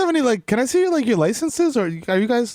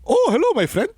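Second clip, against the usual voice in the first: lightly put-on Polish accent; a hint of a Polish accent, high; 8 semitones higher than usual. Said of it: "That was my Polish accent".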